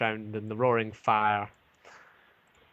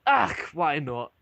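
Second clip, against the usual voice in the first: slow, slightly raised dwarf shrug; dwarf barkeep giving in; 5 semitones higher, 4 dB louder, slower than usual. Acted. Dwarf barkeep agrees to sell the wine, slow and easygoing. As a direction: let the "Ach" roll out slowly, giving in. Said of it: "And goes".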